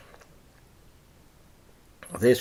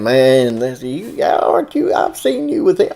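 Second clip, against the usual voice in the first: accusing bystander; 4 semitones higher, 4 dB louder than usual. Acted. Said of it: "everybody's saying".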